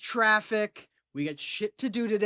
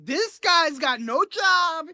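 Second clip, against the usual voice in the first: mock politician; 9 semitones higher, slower than usual. Mocking politician's imagined rant.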